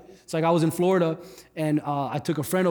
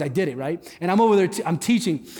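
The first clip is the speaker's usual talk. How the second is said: ordinary talk, nothing marked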